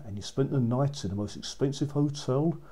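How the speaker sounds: plain reading voice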